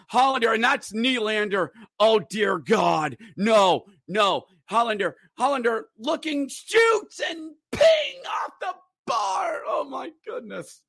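high, shouting hockey call; frantic goal-line call. Real, not acted. Announcer watching the shot ping the bar, shouting. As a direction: yell it as the puck hits the bar.

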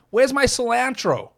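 badly faked American accent, high and drawn out; terrible American accent. Podcaster mocking the American word for coriander, terrible American accent. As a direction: put on a hammy American accent, slow and high-pitched. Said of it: "That's a terrible accent".

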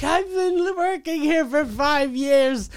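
high, slow mock sob; mock crying. Stripper thrown out by the bouncer, mock crying high. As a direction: whimper it like a thrown-out stripper, hammed up. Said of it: "and she was crying".

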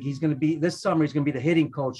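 usual speaking voice

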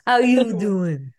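slow, drawn-out New York "How you doing?"; New York accent. Shown as a demonstration. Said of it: "the total New York accent".

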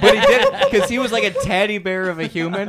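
high, loud laughing speech. Real, not acted. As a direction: talk while laughing hard. Laughing telling the story.

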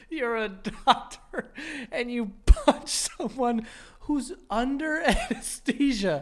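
slow, laughing disbelief; nervous laugh. Real, not acted. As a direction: laugh through the words. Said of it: "nervous laugh reaction".

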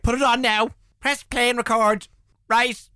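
loud, high send-up of a TV executive; mock TV executive. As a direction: play the pushy producer cutting him off. Mimicking TV bosses' snap verdict.

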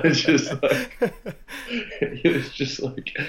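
words breaking up with laughter; laughing. Real, not acted. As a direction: try to talk through the giggles. Cracks up telling story.

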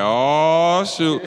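retold dawning realisation. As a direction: let it swell as the news sinks in. Acting out reaction to late deposit.

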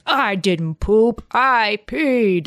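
slow, lightly voiced little boy; a hint of a proud toddler, drawn out; mild toddler voice for the dad; toddler on the potty. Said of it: "the toddler sitting on the potty and he's like".

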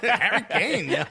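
laughing mock Bronx greeting; Bronx accent. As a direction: call it out like a Bronx homie. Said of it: "Bronx Harry Kane is wild with it".

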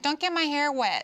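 lightly mock a fussy grandma; grandma impression. Speaker imitating her grandma's hair worry, mock fretting. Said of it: "I've turned into my grandma".

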